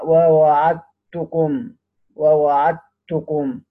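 very slow "ووعدتكم", the د merged into a long ت; idgham of dal into ta. Shown as a demonstration. Teacher illustrating the merge, "ووعدتكم" slowly with doubled t. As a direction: say "ووعدتكم" as wa-wa'at-tukum, holding the tt. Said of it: "هذا إدغام الدال في التاء".

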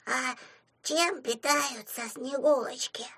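put-on high, soft, slow child voice; curious little imp. A narrator's reading. Small imp asking about the Snow Maiden's food, curious and soft. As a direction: ask it shyly and slowly, like a tot.

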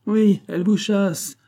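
high, slow mocking retort; acted sarcastic disbelief; scornful. A narrator's reading. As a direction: draw it out with loud scorn. Aunt scoffs at nephew's claim.

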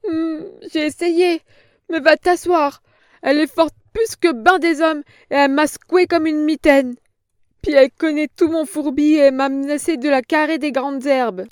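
a weepy frightened hag; whimpering old crone. A narrator's reading. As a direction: snivel out the excuse.

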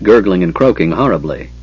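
ordinary delivery, nothing marked

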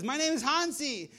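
high, hammed-up Swiss character call; Swiss guy Hansi. Swiss local answering the lost climber, high hammed-up call. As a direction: call out as a cartoonish Swiss mountaineer. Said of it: "we'll say they're from Switzerland".

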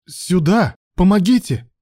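high, loud cry for help; frantic yell. A narrator's reading. Mark screaming for help as Ruslan collapses, frantic yell. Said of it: "завопил истошно Марк".

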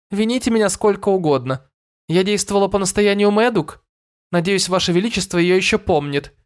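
high fairy voice, unbothered; indifferent. A narrator's reading. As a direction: shrug it off lightly. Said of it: "безразлично пожала плечами". (безразлично)

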